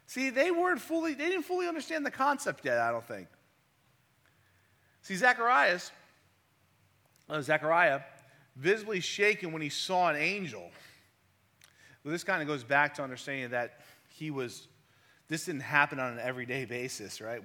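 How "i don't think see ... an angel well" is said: normal talking voice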